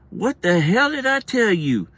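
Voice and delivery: high, loud, drawn-out mom's holler; mom yelling. Retold or imitated. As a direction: holler it slowly like a fed-up mother. Mom yelling from kitchen, retold.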